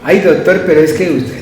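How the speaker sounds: imitated complaining patient. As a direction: mimic a patient's protest to the doctor.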